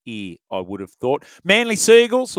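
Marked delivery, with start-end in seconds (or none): 1.4-2.4 announcing loudly. Real, not acted. Announcing new topic.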